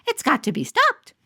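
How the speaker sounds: a prim mother fussing over wildness; fretting mother. Acted. Janie's mother complaining at the town meeting, high and fussy. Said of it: "Janie's mother said".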